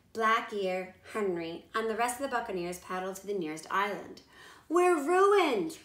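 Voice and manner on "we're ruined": loud, high mock wail; hammed-up despair of a ruined pirate.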